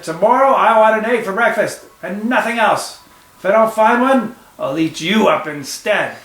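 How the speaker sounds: shouted. A narrator's reading. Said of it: "he shouted".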